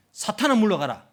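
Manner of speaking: loud, high commanding rebuke; severe rebuke. Acted. Jesus answering Peter's objection, stern loud rebuke. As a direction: order him away forcefully. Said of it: "이 말을 들을 만큼 심각한 말입니까".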